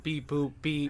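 deadpan machine saying beep boop; robot voice. Pretend. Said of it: "we automated Ryan".

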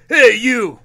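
loud mock call-out; yelling. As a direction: call across the mall. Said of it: "somebody yells like".

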